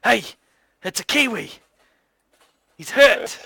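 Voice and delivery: a touch of an alarmed call to the cart; lightly voiced excited stranger. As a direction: lightly play a man spotting a hurt bird. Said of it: "turns back into the cart and says".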